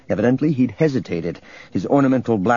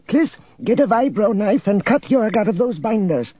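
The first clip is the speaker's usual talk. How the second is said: put-on high, bossy old woman's voice; commanding old woman, pitched way up; old woman's bravura; 11 semitones higher than usual. A narrator's reading. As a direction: give orders in a high-pitched, feisty old voice. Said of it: "Epi's bravura was startling".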